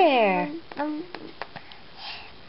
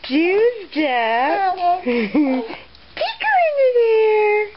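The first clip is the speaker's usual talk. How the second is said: building up, higher and faster; playful rising tickle; 9 semitones higher than usual. Acted. Tickle climax of baby rhyme.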